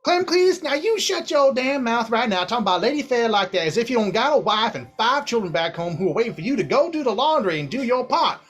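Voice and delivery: angry wife scolding her husband in front of guests. Acted. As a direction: tear into the husband at full speed. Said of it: "you just hear her go".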